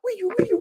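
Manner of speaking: very high siren-like wee-you; high mock alarm chirp; imitated phone alarm. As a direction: mimic the earthquake alert tone. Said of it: "they send you these alerts".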